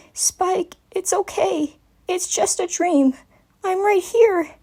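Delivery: tender reassurance; soothing mare. A narrator's reading. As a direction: talk a crying child down gently. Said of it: "keep her voice from sounding strained".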